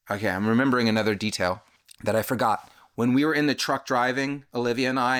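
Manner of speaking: ordinary delivery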